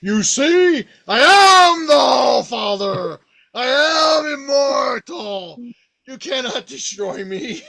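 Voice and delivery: high, slow, loud crying proclamation; triumphant god. Retold or imitated. Allfather rising from the dead, slow tearful shouted cry. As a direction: roar it out, shaking with triumph. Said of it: "raises up into the air again and says".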